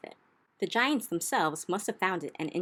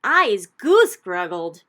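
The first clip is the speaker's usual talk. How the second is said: slow, much louder giant howl; 14 dB louder, slower than usual. Acted. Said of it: "howled the man hugger".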